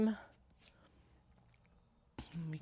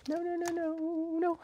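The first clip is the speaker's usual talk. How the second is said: alarmed no; 14 semitones higher, 4 dB louder than usual. Real, not acted. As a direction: cry out as it falls. Fabric falling down.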